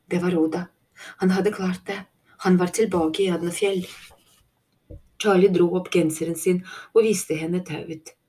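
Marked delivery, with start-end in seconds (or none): none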